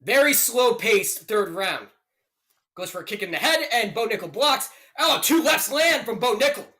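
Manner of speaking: live fight calls, rising with the action; play-by-play commentary. Acted. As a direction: narrate the round like a ringside caller.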